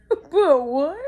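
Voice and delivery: high, loud mock wail; crying child. Child told they were bad, hammed-up crying. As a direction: squeal it like a tearful kid. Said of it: "I'm crying".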